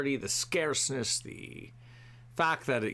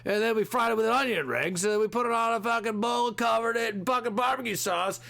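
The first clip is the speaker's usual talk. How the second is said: hyped TV food host; 8 semitones higher than usual. Retold or imitated. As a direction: ham up a breathless food show host bragging. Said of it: "on all these fucking shows".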